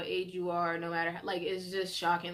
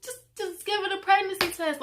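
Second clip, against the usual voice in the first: inner scolding; 10 semitones higher, 5 dB louder than usual. Retold or imitated. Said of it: "in my head, I'm like".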